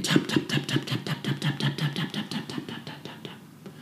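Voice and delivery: hushed taps fading to nothing. A narrator's reading. Narrator sounding the hammering nails, fading to silence. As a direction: let the taps die away into silence.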